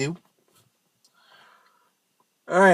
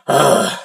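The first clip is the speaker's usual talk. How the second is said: a heavy grunted ugh; heavy sigh; 3 semitones lower, 4 dB louder than usual. Shown as a demonstration. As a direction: let out a big annoyed sigh. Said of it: "you let out a heavy sigh".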